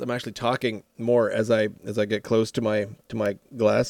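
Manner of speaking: ordinary talk, nothing marked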